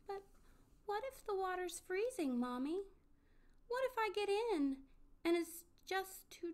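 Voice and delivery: put-on high, anxious child's voice; worried little child. A narrator's reading. Little Pip-Pip asks Mommy about the scary water, worried.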